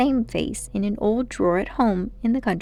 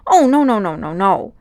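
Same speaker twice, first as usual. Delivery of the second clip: mildly shocked rush of no's, louder at first; 7 dB louder than usual. Acted. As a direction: wave the idea away, scandalised. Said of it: "in a shocked voice".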